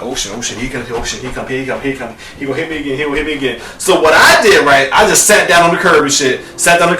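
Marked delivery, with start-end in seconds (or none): 0.0-3.6 much softer and lower, panicked under the breath; panicked mutter. Retold or imitated. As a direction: mumble in terror to yourself. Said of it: "So I was like".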